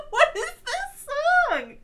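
high, slow, laughing. Real, not acted. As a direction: stretch it out laughing. Laughing at the song.

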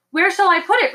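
high, loud strangled question; choked, high-pitched croak. A narrator's reading. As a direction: squeeze out a panicked question. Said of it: "his voice a strangled croak".

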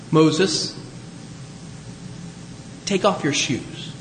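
solemn. Acted. God solemnly instructing Moses.

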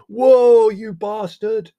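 very loud, high, drawn-out yell; shouting. A narrator's reading. Leslie hanging onto the donkey's tail, shouting. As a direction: bellow at a runaway animal.

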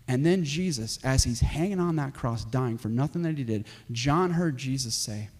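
low, softened retelling; hushed solemn preaching. Real, not acted.

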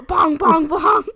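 loud booming "bong"; heavy stomp sounds. Retold or imitated. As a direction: boom out each footstep. Imitating heavy footsteps.

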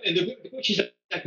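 normal talking voice

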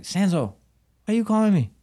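a hint of a baffled boss on the phone; puzzled boss. Gym boss answering a six a.m. call, puzzled.

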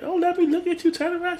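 high-pitched auntie voice; doting aunt. Retold or imitated. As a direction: coo over a visiting nephew in a high voice. Aunt greeting her grown nephew.

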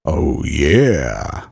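a low, rumbling oh yeah; deep voice. Acted. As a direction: drop the voice very low. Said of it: "I knew you would do something like that".

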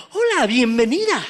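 high, loud, over-eager greeter; effusive mock welcome; gushing welcome. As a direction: greet a favourite with hammed-up enthusiasm. Acted gushing greeter, pretty visitor.

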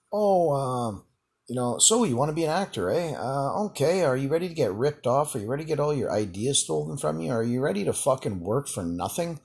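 retold email pitched high, challenging; mock challenge. Retelling a challenging email to would-be actors.

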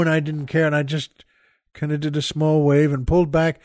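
ordinary talk, nothing marked

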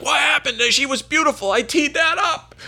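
hammed-up drunk buddy. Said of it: "My buddy's like".

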